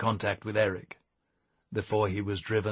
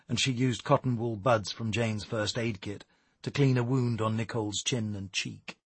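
usual speaking voice

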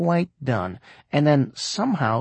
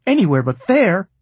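higher, louder mock dread; horror-movie fright; 6 semitones higher, 6 dB louder than usual. A narrator's reading. Mom objecting to his closet, hammed-up fright. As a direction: plead in mock slasher-film terror. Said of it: "in Freddy versus Jason fright".

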